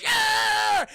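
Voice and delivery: loud, high held yell; startled scream. Retold or imitated. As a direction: scream as if spooked. Hubie screams at the zombie child.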